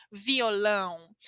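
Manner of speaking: slow violão with a nasal ão at the end. Shown as a demonstration. Teacher stressing the nasal in violão. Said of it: "Vejam a pronúncia da nasal aqui".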